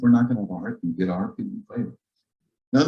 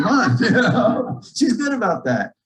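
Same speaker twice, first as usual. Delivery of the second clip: laughing; 10 semitones higher, 5 dB louder, slower than usual. Real, not acted. Joke about wife paying bills.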